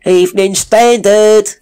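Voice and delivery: very high, loud street call; loud newsboy hawking papers; newsboy cry. A narrator's reading. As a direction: call it across the square. Newsboy calling out.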